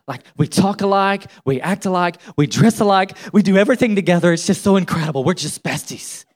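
hammed-up gushing teenage girl; mock two inseparable best friends, building in excitement; teenage besties. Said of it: "teenage girls who are besties".

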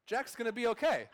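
high, rushed realization; excited relief. Retold or imitated. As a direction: gasp out the relief like a fan. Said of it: "I'm like".

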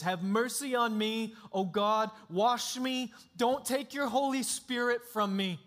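David begging God for mercy; desperate prayer. Retold or imitated. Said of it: "that kind of desperation".

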